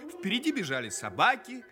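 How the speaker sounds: usual speaking voice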